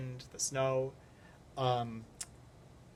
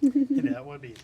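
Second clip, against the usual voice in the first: laughing; 9 dB louder than usual. Real, not acted. Laughing at fish in tree.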